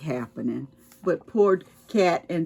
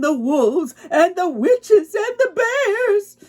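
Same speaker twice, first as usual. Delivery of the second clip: spooky build-up; 12 semitones higher, 5 dB louder than usual. A narrator's reading. Scary forest list, dark woods.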